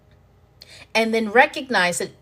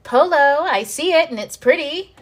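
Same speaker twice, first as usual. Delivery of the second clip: higher, louder happy call back; excited call back; 7 semitones higher, 6 dB louder, slower than usual. Pretend. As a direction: answer brightly like finding a friend in the game. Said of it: "this is me saying".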